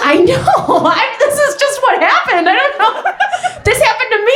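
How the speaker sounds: high, laughing protest; laughing disbelief. Real, not acted. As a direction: giggle it out in disbelief. Defensive laughing aside to audience.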